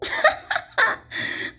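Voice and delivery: airy jeering laugh, high up; high mocking cackle. A narrator's reading. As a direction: cackle at the fool. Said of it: "laughed the sour kangaroo".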